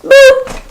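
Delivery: a high silly boop; mock boop. Guest mocking the repetitive kills, with a high boop. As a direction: pop it out small and high. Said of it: "It's all just boop".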